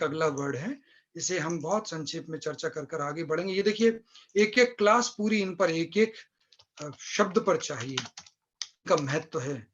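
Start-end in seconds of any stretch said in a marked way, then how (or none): none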